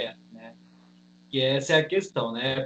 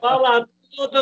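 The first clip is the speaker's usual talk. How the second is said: excited urging; 8 semitones higher, 5 dB louder than usual. Real, not acted. Guest urges host to spill.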